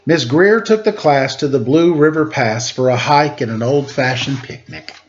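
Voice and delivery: lilting rhymed read-aloud voice; story narration. Acted. Host reading a rhyming picture book to kids, lilting narration. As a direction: read the verse to the children with bounce.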